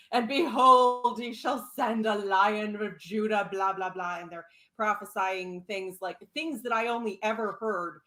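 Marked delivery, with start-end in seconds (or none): 0.1-3.5 mock preaching. Mocking followers' prophecy talk.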